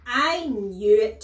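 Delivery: huge, drawn-out triumphant roar; explosive headmistress shout; bellowed outburst. A narrator's reading. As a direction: bellow it slowly in outrage. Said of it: "she bellowed".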